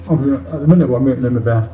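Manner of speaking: normal talking voice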